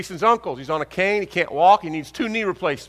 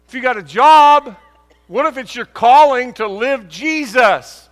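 loud, higher, slowed preaching; full-force preaching; 6 semitones higher, 9 dB louder than usual. Acted. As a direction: drive the point home to the congregation.

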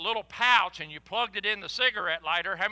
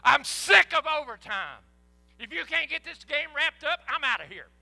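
grumpy fan; 7 semitones higher, 4 dB louder than usual. Retold or imitated. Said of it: "I'd love to see him go".

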